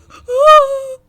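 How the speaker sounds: very loud, high scream of fright; real terrified scream; panicked scream. Real, not acted. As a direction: shriek as the pig hits you. Pig attacking, in panic.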